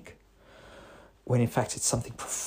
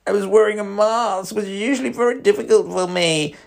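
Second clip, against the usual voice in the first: much higher and louder whiny mockery of the line; demeaning voice; 10 semitones higher, 7 dB louder than usual. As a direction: sneer at it like a drama queen. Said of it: "if you're doing it in that demeaning voice".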